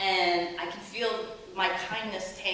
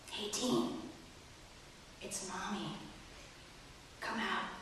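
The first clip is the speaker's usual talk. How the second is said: put-on much softer, near-whisper to a child; soft whispered offer of ice cream; gentle quiet coaxing; 12 dB quieter than usual. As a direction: hush it like soothing a scared kid. Calm mom at bathroom door.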